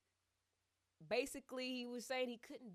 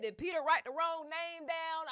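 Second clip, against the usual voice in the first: exasperated plea; 8 semitones higher, 8 dB louder than usual. Retold or imitated. Pleading to God, exasperated.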